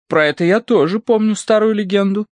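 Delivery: high, lively character voice; animated. A narrator's reading. Sirotina Markelovna recalls an old legend, animated high voice. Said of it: "оживилась Сиротина Маркеловна".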